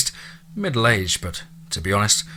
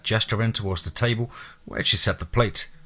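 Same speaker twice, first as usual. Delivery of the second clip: ordinary delivery, nothing marked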